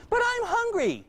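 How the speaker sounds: put-on high whiny kid voice; high-pitched child begging for food. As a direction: whine like a hungry little kid. Child whines for a cookie.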